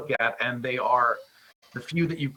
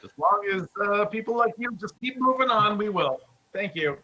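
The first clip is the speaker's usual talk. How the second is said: higher, smug rude guard; dickish guard voice; 8 semitones higher, faster than usual. Acted. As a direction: sneer it like a jerk of a guard. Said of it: "that's my dickish guard voice".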